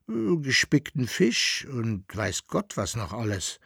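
verschlafen, mit unterdrücktem Gähnen; müde gegen das Gähnen anreden; gegen ein Gähnen ankämpfend. Acted. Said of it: "gegen ein Gähnen ankämpfend". (leicht gähnend, schläfrig gesprochen)

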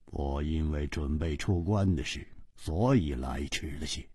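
very low, soft understatement; cool, indifferent. Acted. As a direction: say it quietly, deep in the voice. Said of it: "淡淡的说".

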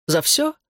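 "За всё?" rising high at the end; questioning answer. A narrator's reading. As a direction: answer "За всё?" as an unsure question, pitch climbing. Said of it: "прозвучало как вопрос".

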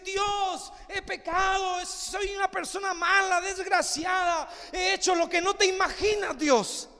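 high, shouted confession; anguished cry to God. Pretend. Pecador clama confesando a Dios. (confesión gritada y desesperada a Dios)

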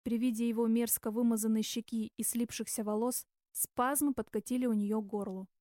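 plain reading voice

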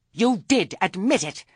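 shrill as a gull, loud accusation. A narrator's reading. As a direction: screech it like an angry gull. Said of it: "voice was shrill as a gull".

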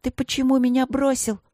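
high-pitched, hurt accusation; reproachful. A narrator's reading. Princess to the tiger who left her, reproachful. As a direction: demand an answer high and reproachfully. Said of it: "проговорила принцесса с упрёком".

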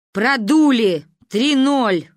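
loud, doleful announcement; sepulchral. A narrator's reading. Father reporting the lost match by phone, sepulchral. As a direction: proclaim the defeat like a death. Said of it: "загробным голосом поделился папа".

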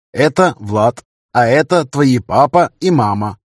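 lightly voiced young woman; pleased, slow introduction; female character voice. A narrator's reading. Lia voiced introducing son. (лёгкий женский голос героини)

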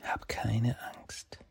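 very slow, hushed whisper. A narrator's reading. Fox strokes the crying boy's hair, whispering. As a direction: whisper it close to his ear. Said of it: "flüstert ihm zu".

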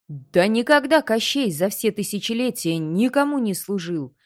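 loud, indignant deathless king; angry Koschei. Acted. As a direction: bark it out, proud and offended. Koschei rages at doll.

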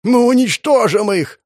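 berserk rage. A narrator's reading. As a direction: scream it in blind fury. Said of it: "закричал жрец".

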